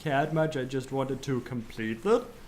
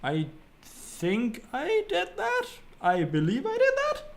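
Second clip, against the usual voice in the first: high-pitched, drawn-out uncertainty; squeaky questioning rise; doubtful recollection; 13 semitones higher than usual. Real, not acted. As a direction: voice climbs high, unsure of itself. Unsure of own recollection.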